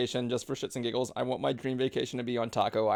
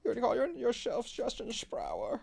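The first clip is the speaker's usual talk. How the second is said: slow, high mocking German voice; 10 semitones higher than usual. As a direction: say the name like a stiff German. Mocking friend's German name.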